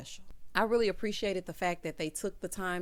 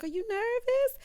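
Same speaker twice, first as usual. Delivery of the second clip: worried mom. Retold or imitated. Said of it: "she's like".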